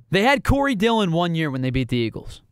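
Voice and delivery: normal talking voice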